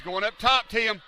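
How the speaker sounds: excited commentary. Real, not acted. Play-by-play as wrestler climbs.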